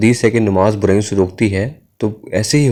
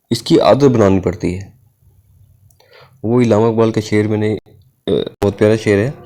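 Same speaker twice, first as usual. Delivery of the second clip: ordinary delivery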